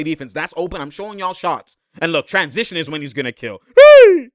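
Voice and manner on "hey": high loud shout; hyped shout; excited yell. Real, not acted. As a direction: yell hey at the screen.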